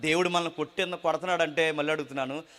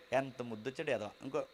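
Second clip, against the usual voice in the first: mock cooing gloat; 5 semitones lower, 10 dB quieter than usual. Caregiver gloating before striking again.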